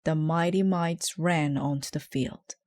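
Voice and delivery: ordinary delivery, nothing marked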